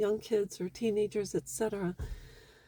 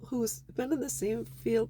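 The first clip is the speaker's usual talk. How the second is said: usual speaking voice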